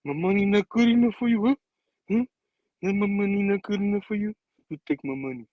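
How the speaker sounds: mock mob boss, slightly put-on voice; Godfather. Hammerhead insisting Peter take cash, in a Godfather voice. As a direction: put on an offended movie don. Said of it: "like, like Godfather".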